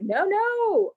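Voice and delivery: high, swooping mock refusal; imitated sarcastic refusal. Angry lady turns down the apology, sarcastic.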